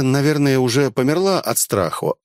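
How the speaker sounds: ordinary delivery, nothing marked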